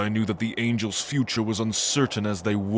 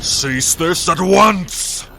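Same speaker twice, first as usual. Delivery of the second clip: high, loud, slow command; commanding order; 9 semitones higher, 9 dB louder, slower than usual. Acted. Old angel lord commanding the battle to stop. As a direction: stop the fight with authority.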